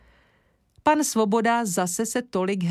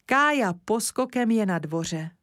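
plain reading voice

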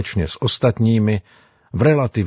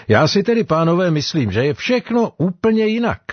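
much higher-pitched character voice, putting forward an opinion; another voice joining in; 8 semitones higher, faster than usual. A narrator's reading. Sailor joins the debate with a different opinion, high-pitched character voice. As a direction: chime in with a high, raised-pitch voice. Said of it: "hlásil se do diskuze další hlas".

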